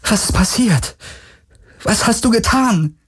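high-pitched, trembling alarm; scared, high female character voice; frightened. Acted. Trembling woman after a terrifying vision.

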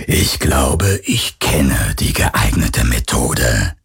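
low, very slow ghostly voice; menacing phantom. A narrator's reading. The phantom mocks Garland's bluff, low and slow. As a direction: let it drip with dark amusement. Phantom hints at cutting arm.

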